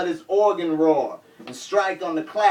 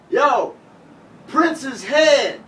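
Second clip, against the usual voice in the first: louder, higher shout of the song title; calling out; 9 semitones higher, 5 dB louder, much slower than usual. Retold or imitated. Rapper calling the next song to the band, loud shout. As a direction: call it out to the band. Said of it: "so I called out".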